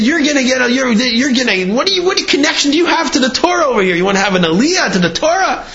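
scolding the informer, voice high up; furious rabbi. Retold or imitated. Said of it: "He was furious".

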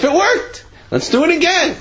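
high-pitched, thrilled announcement; excited good news. Acted. Yid telling his wife the offering worked, excited.

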